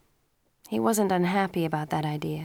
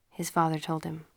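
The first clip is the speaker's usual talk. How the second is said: ordinary delivery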